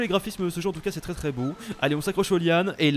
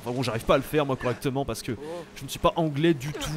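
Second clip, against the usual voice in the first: ordinary delivery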